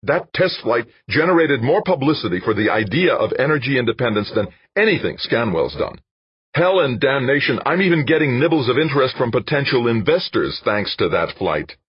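loud, high-pitched angry tirade; furious, forceful outburst; exploding. A narrator's reading. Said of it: "Dan exploded".

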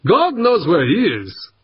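high, exasperated disbelief; incredulous reply. A narrator's reading. Operator answers the stranger's demand for Fuchs, incredulous. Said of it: "only half believing his ears".

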